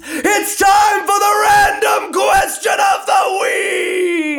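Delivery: high, slow, drawn-out big shout; hammed-up game show announcer. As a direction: belt it out like a game show host. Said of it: "the only way I could tolerate that".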